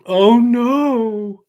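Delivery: loud, high, drawn-out cry of dismay; hammed-up distress; mock horror. Host teasing co-host about turning thirty, mock horror.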